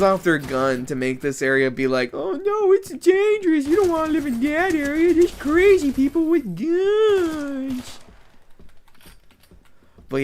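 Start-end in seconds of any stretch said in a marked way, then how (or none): 2.1-8.0 high, whiny mock panic; mock scared voice. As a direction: squeal it like a frightened snob. Mocking scared buyers' quote.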